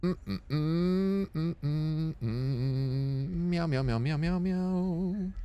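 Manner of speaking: hums then mews in a high little cat voice; playful cat meows. Pretend. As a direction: meow like a playful kitten, sing-song. Humming then meowing at the game.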